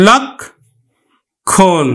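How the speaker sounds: লক্ষণ very slowly as lawk, pause, khon; split open syllables. Shown as a demonstration. Teacher breaking the m-conjunct word down, slow lawk... khon. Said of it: "এখানে উচ্চারণটা আমার".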